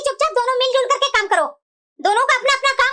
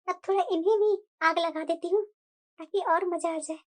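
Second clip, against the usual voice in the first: scheming aside; 5 semitones lower, 6 dB quieter than usual. Acted. Sister-in-law plotting to herself, a hushed aside. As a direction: quietly gloat and plot, slowing down.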